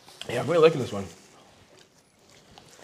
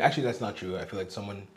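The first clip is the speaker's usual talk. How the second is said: normal talking voice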